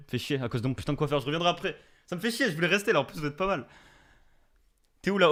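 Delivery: ordinary talk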